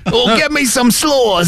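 high, slow mock Brit; drawn-out comic British demand; British accent. Joining the tourist riff, British accent. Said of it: "an old guy with a British accent".

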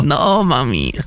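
hammed-up childish refusal; whiny child. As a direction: play a reluctant kid, dragging it out. Child role in the bit.

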